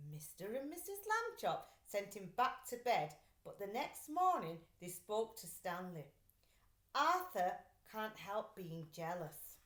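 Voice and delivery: ordinary delivery, nothing marked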